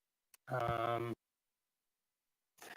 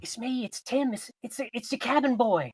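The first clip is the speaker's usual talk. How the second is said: put-on high, pleading teen cabin boy; eager kid begging to be recognised; eager cracking teen voice; 7 semitones higher, 3 dB quieter than usual. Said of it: "and he says".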